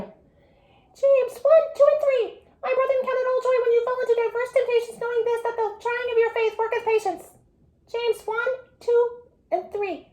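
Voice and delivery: super high, squeaky recital; chipmunk voice. Shown as a demonstration. Teacher leading the last group's verse, chipmunk voice. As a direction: race through it way up high like a chipmunk. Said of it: "Can you guys do chipmunk voices?".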